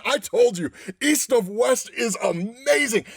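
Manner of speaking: usual speaking voice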